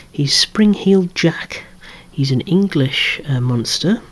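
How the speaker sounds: ordinary talk, nothing marked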